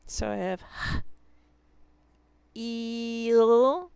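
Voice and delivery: "h-" then a stretched "eel"; segmenting "heal" for students, stretched "eel"; sounding out heal. Shown as a demonstration. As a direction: split "heal" into "h-" and a drawn-out "eel". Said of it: "e sound in heal".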